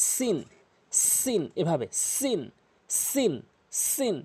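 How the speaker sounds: সিন with a strong, hissing s; hard s. Shown as a demonstration. Teacher drilling the letter, forceful sharp-s সিন. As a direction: press the s of সিন firmly. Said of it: "শক্ত করে উচ্চারণ করবেন".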